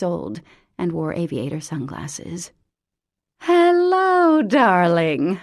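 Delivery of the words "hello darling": loud, high sing-song hello; calling out. A narrator's reading. Mother to daughter, a loud gushing call. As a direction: call across the yard, gushing. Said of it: "her mother called".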